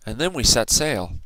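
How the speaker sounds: plain reading voice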